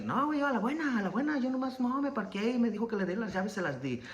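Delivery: high, put-on Mexican paisa voice in Spanish; imitating the paisa. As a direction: voice the older paisa telling how he gave up the keys.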